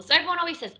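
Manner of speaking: ordinary delivery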